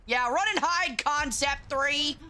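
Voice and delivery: high mocking taunt. Real, not acted. Taunting fleeing enemy tank.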